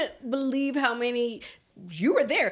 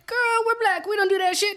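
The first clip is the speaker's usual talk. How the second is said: high-pitched mock mom refusal; imitating mom; 9 semitones higher than usual. Speaker quoting mom turning down a quinceañera, mock dismissive. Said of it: "she was like, she was like".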